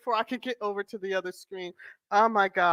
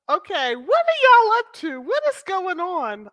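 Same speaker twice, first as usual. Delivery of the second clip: high, loud what's going on; high amazed ask; surprised; 11 semitones higher, 6 dB louder than usual. Real, not acted. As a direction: caught off guard question. Surprised at viewers.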